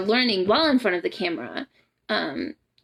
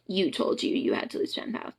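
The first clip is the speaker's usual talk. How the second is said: hushed whisper; 3 semitones lower, 6 dB quieter than usual. Real, not acted. Repeats the point, hushed.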